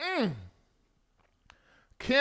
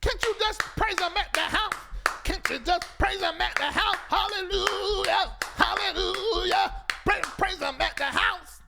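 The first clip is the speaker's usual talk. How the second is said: raised, rhythmic hallelujah call; preacher-style pleading; 8 semitones higher than usual. Acted. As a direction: plead it high like a preacher working the congregation. Exasperated preacher-style plea to churchgoers.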